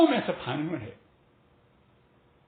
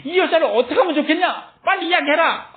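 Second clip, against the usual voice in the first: higher and louder mob demand; shouting crowd; 7 semitones higher, 7 dB louder than usual. Retold or imitated. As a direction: bark it like an angry crowd pressing for an answer. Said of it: "소리를 지르면서". (소리 지르며 다그치는 군중)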